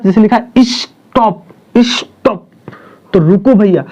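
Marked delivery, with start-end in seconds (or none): 0.6-2.4 stop pushed out higher, broken before the last sound burst; teacher's demo of pressure on stop; stress on the word. Shown as a demonstration. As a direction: punch each stop with a pause between. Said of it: "जब पहले word पे जोर".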